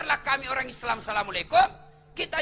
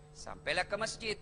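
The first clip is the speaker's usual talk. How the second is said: ordinary talk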